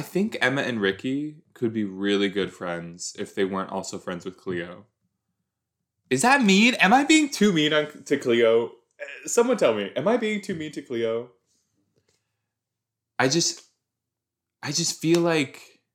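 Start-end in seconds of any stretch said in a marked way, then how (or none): 6.1-10.5 high, loud anxious question; worried outburst. Real, not acted. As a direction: blurt it out, suddenly worried. Asking viewers if she's too mean.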